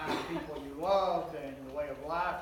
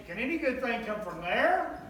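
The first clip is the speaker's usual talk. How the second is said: teasing, higher sneer at the town; mock scorn; 5 semitones higher, 4 dB louder than usual. Preacher ribbing a local town, mock scornful. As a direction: jab at the hometown with a grin. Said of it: "it's kinda like Comanche".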